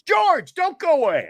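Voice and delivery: high, loud retold call. Host stopping the newsman leaving, calling out. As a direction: holler after him, pitched right up.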